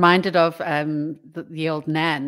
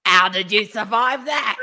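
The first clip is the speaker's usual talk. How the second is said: cheeky Nan; 4 semitones higher, 6 dB louder than usual. Retold or imitated. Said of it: "And she goes".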